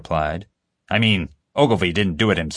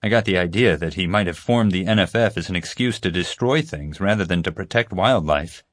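ordinary delivery, nothing marked

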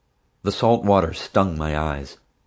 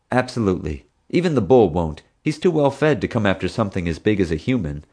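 ordinary delivery

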